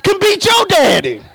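chanting my daddy can whoop your daddy; playground taunt. Retold or imitated. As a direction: boast like a child in the schoolyard. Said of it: "you know how we said on the playground".

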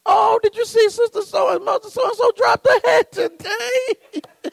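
high, weepy church-lady wail; mock tearful woman. Church woman weeping over a dropped hat, mock crying. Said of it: "woman probably start crying".

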